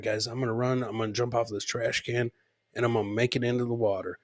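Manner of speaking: usual speaking voice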